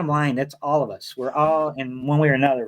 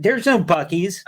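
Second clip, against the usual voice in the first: ordinary talk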